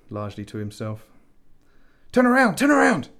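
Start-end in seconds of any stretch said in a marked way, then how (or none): 2.1-3.1 high, loud urgent shout; outburst. Acted. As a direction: yell a warning at a distant ship. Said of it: "Death's outburst".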